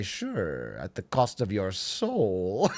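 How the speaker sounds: low, drawn-out devilish offer; slow mock-devil deal-making. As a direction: seal a sinister bargain, slow and low. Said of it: "devilish smile".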